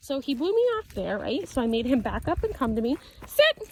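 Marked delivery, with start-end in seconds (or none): 3.3-3.7 high, loud, sharp command; firm dog command. Real, not acted.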